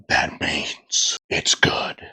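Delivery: hushed whisper. Real, not acted. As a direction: drop to a whisper. Hushed aside about clamp.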